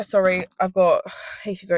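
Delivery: ordinary talk, nothing marked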